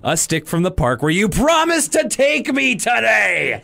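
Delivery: high, forced adult-woman freakout; bad acting. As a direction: put every ounce of force in. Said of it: "it sounds like an adult woman".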